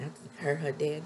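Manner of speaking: normal talking voice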